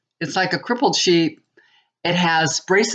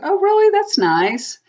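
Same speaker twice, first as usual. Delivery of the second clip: mock delight; 13 semitones higher than usual. Wife mocking husband's party news, with sarcastic high delight. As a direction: act thrilled when she's really not.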